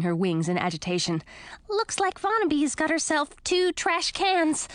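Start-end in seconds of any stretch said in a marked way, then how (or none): 1.7-4.7 high, lively little bat. Acted. Lil the bat speaks.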